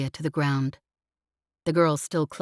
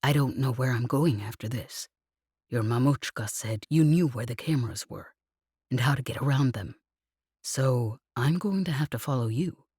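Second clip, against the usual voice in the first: lowered man voice; 4 semitones lower than usual. A narrator's reading. Lev voiced, low whispering.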